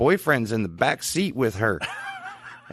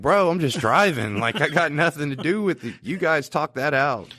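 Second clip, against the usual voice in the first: defensive driver; 7 semitones higher than usual. Retold or imitated. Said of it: "And I'm like".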